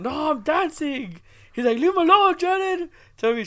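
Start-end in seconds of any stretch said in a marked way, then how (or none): 0.0-1.2 high, slow, drawn-out refusal; mock kid's defiance. Retold boy refusing to sit. 1.8-2.9 high-pitched imitation of an indignant kid; mock kid's protest. Retold boy defending his cousin.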